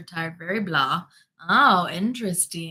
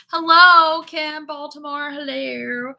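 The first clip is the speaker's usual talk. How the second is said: loud high hello; 8 semitones higher, 8 dB louder, slower than usual. Real, not acted. Host hailing a viewer in chat, loud and high.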